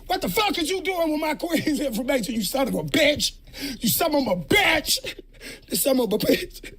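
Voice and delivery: high, worked-up outrage; outraged disbelief. Real, not acted. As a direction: yell it high in shocked indignation. Outraged at video.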